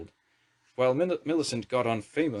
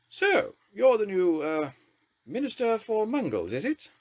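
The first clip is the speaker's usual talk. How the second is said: much higher, slow grand lady's drawl; Thatcher's drawl; 11 semitones higher, 4 dB louder, slower than usual. A narrator's reading. Prime Minister greeting the wizard minister with a haughty drawl. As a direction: look down on the new minister. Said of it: "in her home county's drawl".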